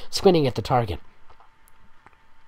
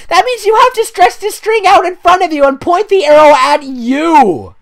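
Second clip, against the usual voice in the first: high, loud disbelieving yell; shrieking at how dumb it is; incredulous shouting; 14 dB louder than usual. Real, not acted. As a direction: scream in disbelief at the page. Mocking fanfic archery fail.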